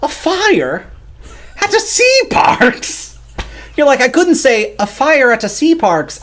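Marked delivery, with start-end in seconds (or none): 0.0-3.2 high, slow Irish accent on "a fire at a sea parks". Shown as a demonstration. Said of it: "better at an Irish accent". 4.0-6.2 a rough, leprechaun-like Irish accent. Shown as a demonstration. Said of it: "I sound like a leprechaun".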